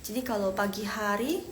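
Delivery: ordinary delivery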